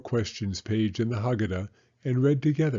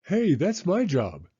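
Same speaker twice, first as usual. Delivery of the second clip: higher, slower protesting big brother; indignant boy; 8 semitones higher, slower than usual. A narrator's reading. Brother Jake objecting to his sister's turn, indignant. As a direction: stake your claim on the job. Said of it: "said Jake".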